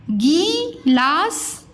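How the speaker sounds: गिलास slowly as gee-laas; गलत लंबी ई. Shown as a demonstration. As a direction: stretch गि into गी in गिलास. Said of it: "गिलास नहीं बोलते हैं". (गिलास को गीलास खींचकर)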